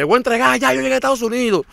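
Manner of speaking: high, loud, elated retelling; acted-out joy at having made it; happy announcement. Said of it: "Tú estás loco y feliz".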